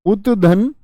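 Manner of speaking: usual speaking voice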